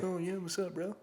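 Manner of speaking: a hint of a surfer dude greeting; surfer dude impression. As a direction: play him as a mellow Cali bro. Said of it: "talks like a Cali surfer dude".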